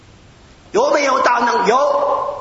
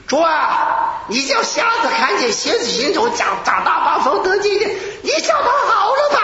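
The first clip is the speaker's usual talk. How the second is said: a hammed-up fervent plea, pitch climbing to the end; fervent prayer; 11 semitones higher than usual. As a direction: imitate his young zealous shouted prayer. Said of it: "我就这么祷告的".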